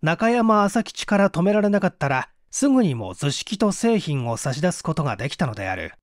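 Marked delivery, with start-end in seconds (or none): none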